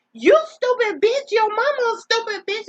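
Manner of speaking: high, loud mock yell at the caller; mama yelling back. Retelling how mama cussed out the scam caller, a high loud mock yell. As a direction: shout it back like an outraged mama. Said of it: "And she said".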